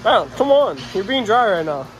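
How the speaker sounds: ordinary delivery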